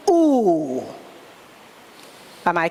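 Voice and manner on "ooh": long sustained ooh; sung ooh on exhale. Shown as a demonstration. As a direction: breathe out on a held ooh. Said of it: "on the sound".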